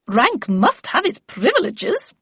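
slow singsong with big pitch swoops. A narrator's reading. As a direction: proclaim it gaily, stretching every stressed word. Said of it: "cried Miss Havisham gaily".

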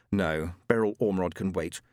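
ordinary delivery, nothing marked

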